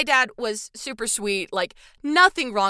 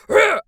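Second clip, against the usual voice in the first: short growl, higher and louder; mock growl; 11 semitones higher, 9 dB louder than usual. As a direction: play a grumpy bear snarling. Husband switches to stern mode.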